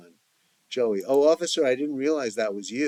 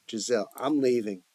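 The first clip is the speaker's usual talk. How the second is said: ordinary delivery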